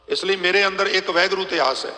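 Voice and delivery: ordinary talk, nothing marked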